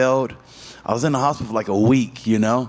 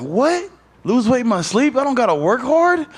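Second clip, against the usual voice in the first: retold disbelief; 11 semitones higher than usual. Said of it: "I'm like".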